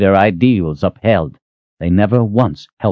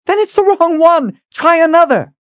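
shouting; 4 dB louder than usual. Acted. Shouting up to Chandalin.

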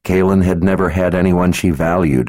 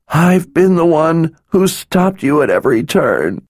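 weeping; 12 semitones higher than usual. A narrator's reading. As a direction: pour it out through tears. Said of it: "She wept in utter misery".

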